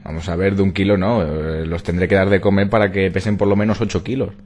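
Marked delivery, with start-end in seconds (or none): none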